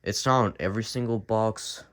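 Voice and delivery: ordinary talk, nothing marked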